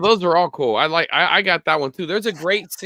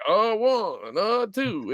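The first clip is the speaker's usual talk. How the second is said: put-on drunk slurring; 6 semitones higher, much slower than usual. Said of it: "you start to pick up on he's slurring".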